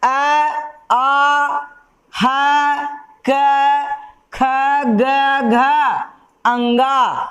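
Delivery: each letter "अ", "आ", "ह", "क" said alone with pauses between; teacher demonstrating the throat sounds "क ख ग घ"; sounding out letters. Shown as a demonstration. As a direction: pronounce "क", "ख", "ग", "घ" one by one from the throat. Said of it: "जैसे".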